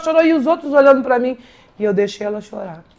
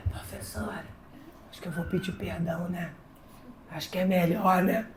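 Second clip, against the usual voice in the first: very soft, hushed asking; ashamed student quietly proposing an apology; timid whisper; 3 semitones lower, 14 dB quieter than usual. Retold or imitated. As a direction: murmur it shyly to the teacher. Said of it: "eu tava falando baixo com ela".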